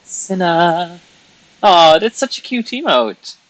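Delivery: high, loud "aw"; gushing delight. Real, not acted. Streamer gushing over a viewer's emote. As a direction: coo over something cute.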